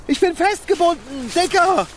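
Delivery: panicky plea. Acted. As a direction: beg your friend for help. Gefesselt, ruft um Hilfe. (panisch um Hilfe bitten)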